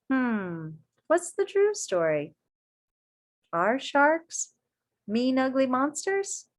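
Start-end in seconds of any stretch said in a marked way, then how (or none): none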